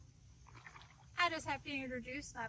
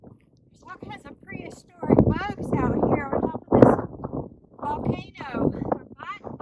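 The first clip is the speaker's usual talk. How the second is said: loud and much higher, a hammed-up yelp of complaint; bug-bitten shout; 8 semitones higher, 19 dB louder than usual. Swatting at bugs on the volcano, loudly yelling to Eleanor. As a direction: holler about the bugs like they're eating you alive.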